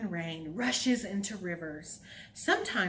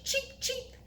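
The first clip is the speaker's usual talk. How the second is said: put-on baby chick; 3 dB quieter than usual. Shown as a demonstration. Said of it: "Can you cheep like a baby chick?".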